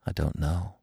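very soft, low answer. A narrator's reading. Bambi answers Feline in her same soft tone, quiet and low. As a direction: answer quietly, almost under the breath. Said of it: "Bambi answered in the same tone".

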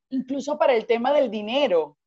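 ordinary talk, nothing marked

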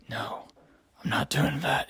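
hushed, breathy mock Batman whisper; a whispered, grim Batman refusal. Hero refusing the military's orders, in a whispered Batman voice.

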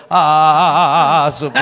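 long, loud drawn-out "ah"; hammed-up massage groan; playing with the vowel. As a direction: stretch the groan on an open A. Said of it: "yo jugaba con el aves".

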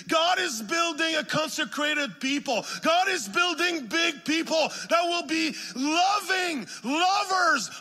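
high, loud, driving sermon voice; full-force revival preaching; fervent preaching. Acted. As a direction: preach it out to the whole church, building fire.

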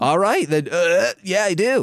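talking while holding back a burp; stifled burp. Real, not acted. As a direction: swallow a burp mid-sentence.

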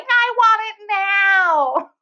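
put-on high, very slow drawn-out child's demand; mock toddler whine; whiny toddler. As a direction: stretch it out like a sulking little kid. Toddler whining at Disney closure.

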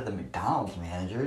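ordinary talk, nothing marked

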